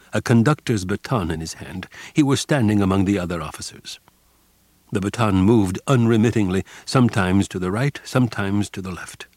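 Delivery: plain reading voice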